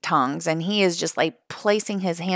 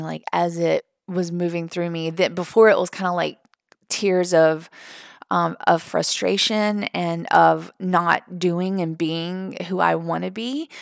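ordinary talk, nothing marked

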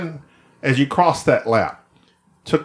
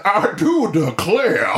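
higher, slow Georgian drawl; hammed-up genteel Georgian flourish; Georgian accent; 4 semitones higher, slower than usual. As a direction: declare it in a drawn-out mock Georgian accent. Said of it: "every sentence we start with has to start".

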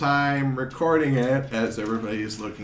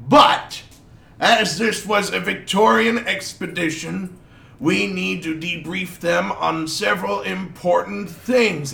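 higher, louder noble's speechmaking voice; pompous duke; 6 semitones higher, 6 dB louder than usual. Acted. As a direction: address the crowd like a self-important duke. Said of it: "and he says".

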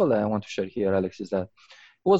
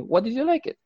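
incredulous question; 14 semitones higher than usual. Retold or imitated. I was like.

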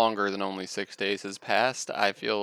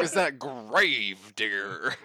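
monster truck announcer; much slower than usual. Pretend. Joking about monster truck noise.

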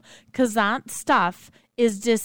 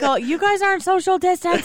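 mocking scold; 8 semitones higher, 6 dB louder than usual. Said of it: "they're like".

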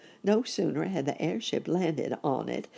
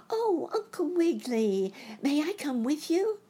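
put-on much higher, pleading little-boy voice; eager boy rabbit; 13 semitones higher than usual. A narrator's reading. Boy rabbit asking his uncle for a ride, high eager child voice. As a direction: pipe up high like a hopeful child.

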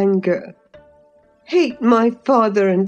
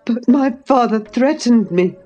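acted frightened boy, raised in pitch; gasping; 7 semitones higher, 3 dB louder than usual. A narrator's reading. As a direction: blurt it out in mild panic, pitched high. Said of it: "gasped the boy".

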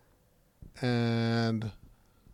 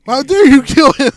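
loud, high hammed-up indignation; shouting mock fury over the villain's death; mock outrage; 10 semitones higher, 13 dB louder than usual. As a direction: scold the hero like a wounded fan. Mock outrage at killing Satan.

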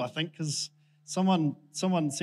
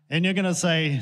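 ordinary delivery

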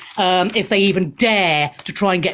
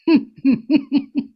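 scornful mock Putin laugh, rising; 4 semitones higher than usual. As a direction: laugh him off as Putin would. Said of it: "he'll be like".

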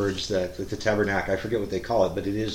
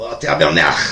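louder mock yell of a stomped Quebecer; imitating a yelled curse; 2 semitones higher, 11 dB louder than usual. Uncle yelps after someone stomps his foot, mock yell. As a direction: yell "Oh, tabernacle" in a put-on Quebec voice. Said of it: "they yell".

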